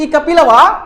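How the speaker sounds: loud mocking call of the name; loud teasing shout. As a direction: jeer at a friend. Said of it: "एक दोस्तवा बोले थे".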